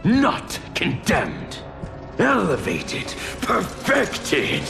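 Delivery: exultant villain correcting a doubter; fervent proclamation. Acted. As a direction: proclaim it like a zealot, each word ringing out. Villain exalts his plan, correcting captive.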